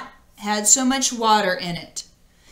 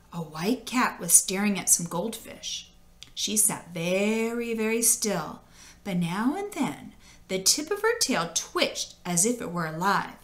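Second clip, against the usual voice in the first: hushed; 2 semitones lower, 7 dB quieter than usual. A narrator's reading. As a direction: keep it quiet as the cat. Hushed cat description.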